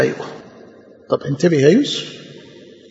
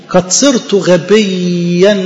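slow verse; 3 dB louder, much slower than usual. Shown as a demonstration. Slow quoted verse, repeated.

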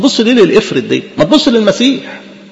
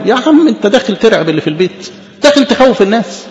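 ordinary talk

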